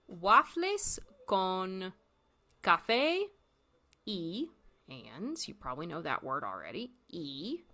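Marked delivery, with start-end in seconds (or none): 0.1-4.5 waffles con said very slowly and clearly; teacher modelling waffles con slowly; slow model. Shown as a demonstration. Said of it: "so that you can copy me".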